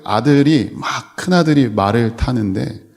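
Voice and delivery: ordinary talk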